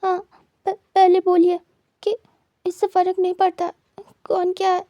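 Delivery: calm yourself mid-sob and insist; steadying a breaking voice through tears; crying. A narrator's reading. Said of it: "रोते हुए खुद को शांत करता है".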